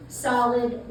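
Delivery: usual speaking voice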